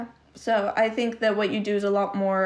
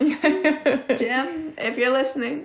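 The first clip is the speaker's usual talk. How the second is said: laughing; 5 semitones higher, much slower than usual. Real, not acted. Teasing shout-out to a client.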